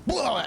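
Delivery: a sudden high, loud winded gasp of "blah"; gasping cry as he hits the grass. Acted. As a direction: get the wind knocked out of you. Knocked out of air, tumbling.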